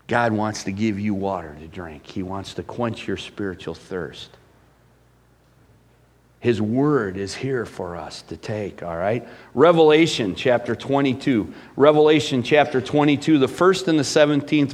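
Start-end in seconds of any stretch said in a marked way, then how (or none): 0.0-9.2 low, hushed pastoral appeal; soft, tender preaching. Real, not acted. Preacher offers God's water to the thirsty church, in soft low preaching. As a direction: bring it right down, low and soft.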